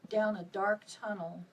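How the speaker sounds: ordinary delivery, nothing marked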